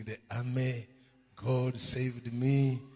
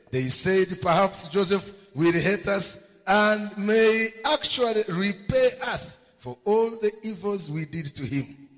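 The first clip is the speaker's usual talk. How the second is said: loud, raised scripture reading; impassioned preaching; 5 semitones higher, 8 dB louder than usual. A narrator's reading. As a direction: read scripture out forcefully to the congregation.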